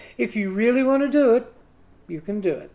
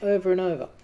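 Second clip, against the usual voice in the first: ordinary delivery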